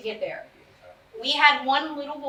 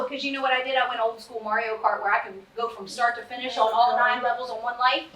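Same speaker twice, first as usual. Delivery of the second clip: usual speaking voice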